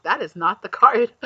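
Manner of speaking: playful self-scold; self-correcting. Real, not acted. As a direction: catch your own mistake. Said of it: "I'm like".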